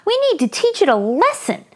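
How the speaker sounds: put-on lively read-aloud for children; story narration. A narrator's reading. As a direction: tell it brightly to young listeners.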